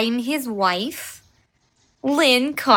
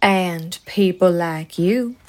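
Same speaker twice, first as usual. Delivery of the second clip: put-on southern accent; 8 semitones lower than usual. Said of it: "Her southern accent".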